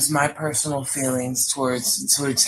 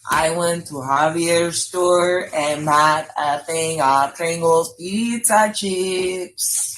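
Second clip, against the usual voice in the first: slow, careful demo sentence; slow example sentence; slower than usual. Shown as a demonstration. Host demonstrating the word in a sentence, slow. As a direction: spell it out slowly. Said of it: "Use the word pizza chip in a sentence".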